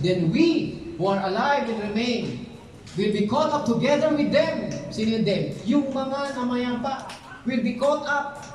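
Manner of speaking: preaching climax; scripture reading. Real, not acted. Scripture read aloud, climaxing.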